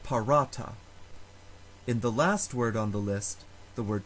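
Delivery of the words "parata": "parata" with a long stressed RA; stress on middle syllable. Shown as a demonstration. Teacher showing a long vowel takes stress, middle-syllable stress. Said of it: "So that syllable receives the stress".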